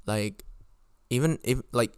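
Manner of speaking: ordinary talk, nothing marked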